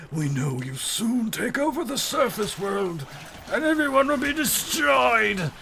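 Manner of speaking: high-pitched, hammed-up doomsday wail; mock doom prophecy. Accusers warning of wombat takeover, hammed-up high wail.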